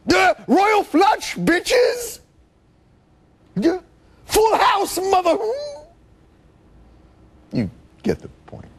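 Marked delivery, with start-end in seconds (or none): 0.0-2.2 very high, loud mock gloating shout; hammed-up poker victory shriek; blurted outburst. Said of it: "blurts out the hand". 3.6-5.4 high, loud mock victory yell; hammed-up gloating poker outburst; blurted outburst. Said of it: "adds an expletive".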